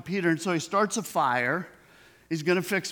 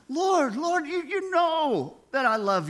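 much higher, pained insistence; anguished reply; 11 semitones higher than usual. Retold or imitated. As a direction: Peter pleading, voice strained and raised. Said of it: "He says".

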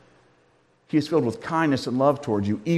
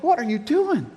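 high-pitched, incredulous challenge; mock affronted; 9 semitones higher than usual. Woman confronting grandpa over her cookies, mock affronted. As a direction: question him in high, mock disbelief. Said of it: "gives him a look like".

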